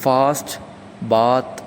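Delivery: ഫാസ്റ്റ് and ബാത്ത് with a long open British aa vowel. Shown as a demonstration. Teacher demonstrating the RP vowels in fast and bath. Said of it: "received പ്രൊനൗൺസിയേഷനിൽ".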